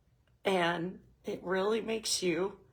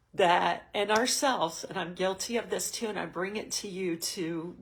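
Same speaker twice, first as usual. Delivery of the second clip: usual speaking voice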